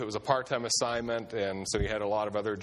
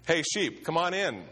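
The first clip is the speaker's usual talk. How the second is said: raised call, pitched high and pushed loud; projected call; 6 semitones higher than usual. Retold or imitated. Youth pastor trying to project to the sheep, loud call. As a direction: project it across the field to the sheep. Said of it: "speak loudly".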